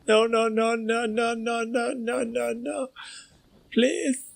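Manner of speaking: very high, squeaky pleading bear; comic high-voiced bear pleading on repeat; pleading bear's accent. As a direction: beg in a high-pitched put-on voice. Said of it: "why that's his accent".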